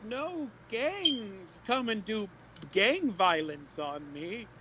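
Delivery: put-on high, slow old woman voice; frail shaky old whisper. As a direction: play the helpless granny. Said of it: "look like an old woman".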